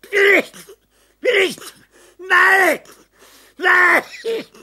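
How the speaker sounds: high, loud cries of refusal; frantic screaming refusal; desperate cry. Acted. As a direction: cry out no in panic. Delirious refusal, crying out.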